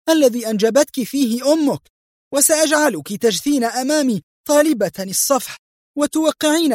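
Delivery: high-pitched, frenzied threats; agitated rage. A narrator's reading. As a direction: threaten the prisoner in a sustained high fury. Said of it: "وهي تستطرد في هياج".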